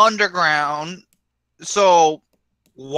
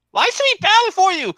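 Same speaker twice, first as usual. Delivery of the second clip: high mock follower voice; 14 semitones higher, 3 dB louder than usual. Mock follower questions the villain.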